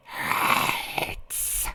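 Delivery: whispery, voiceless creature burble; drawn-out watery rasp of a hurting monster; pained burble. A narrator's reading. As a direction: burble it out in pain, as if from underwater. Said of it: "the voice burbled, pain obvious in its tone".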